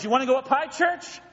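playful God teasing. Retold or imitated.

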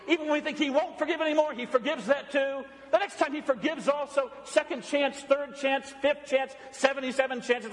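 high-pitched, driving pulpit crescendo; fervent preaching. Acted. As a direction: pile up each phrase with evangelical urgency. Preaching forgiveness at full force.

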